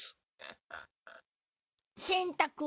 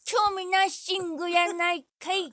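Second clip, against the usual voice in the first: loud retort; 8 semitones higher, 9 dB louder than usual. Real, not acted. Comic retort to partner.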